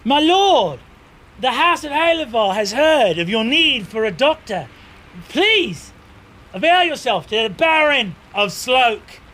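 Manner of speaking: high, loud formal announcement; announcing knight. Acted. Knight announces visitors to his lord.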